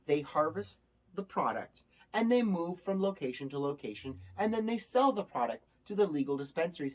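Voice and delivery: ordinary talk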